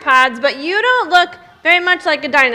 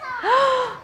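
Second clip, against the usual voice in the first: excited gasp; 10 semitones higher than usual. Acted. Excited gasp before reveal.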